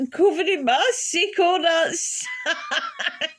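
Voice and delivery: words squeezed out high through laughter; barely getting it out for laughing; laughing while telling. Real, not acted. Telling friends the vomit story, high helpless laughter.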